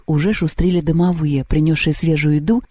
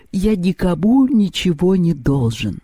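slow words stretched by a yawn; 2 semitones higher, slower than usual. A narrator's reading. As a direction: keep yawning as you say it. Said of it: "зевнул Перун".

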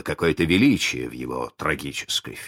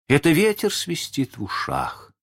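higher-pitched pirate voice; 10 semitones higher than usual. A narrator's reading. Pirates agree it is only wind, higher voice. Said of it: "решили пираты".

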